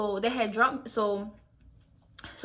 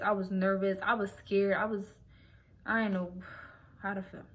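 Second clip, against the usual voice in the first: ordinary talk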